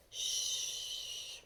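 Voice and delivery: whispered shh from a mother cow; soft hush. A narrator's reading. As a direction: hush gently so the calf sleeps. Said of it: "mooed Clarissa softly".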